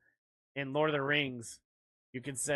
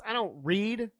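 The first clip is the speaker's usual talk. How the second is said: ordinary delivery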